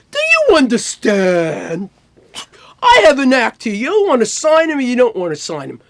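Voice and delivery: imitated hard-dealing lawyer. Lawyer on the phone haggling over signing, imitated emphatic ultimatum. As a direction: act out a crazy lawyer's phone demand.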